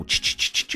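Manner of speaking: whispered ch-ch-ch insect noise; imitated insect chirr. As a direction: hiss out a jungle critter sound.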